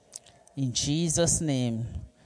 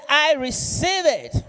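a much higher, much louder preacher's cry; preaching shout; 10 semitones higher, 5 dB louder than usual. Real, not acted. Preacher leading the congregation in a shouted response, full-force shout. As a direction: belt it out to lift the whole room.

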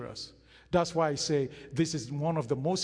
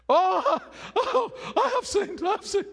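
high, loud panicked cry; hammed-up, high-pitched outcry of guilt; mock panicked wail; 7 dB louder than usual. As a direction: wail it like a man suddenly losing his throne. Mocks Saul's panicked confession at dethronement.

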